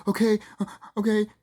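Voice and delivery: slightly high, hesitant mock agreement; retold meek surrender. Executive yielding to the intruder, meek surrender. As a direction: imitate her giving in haltingly.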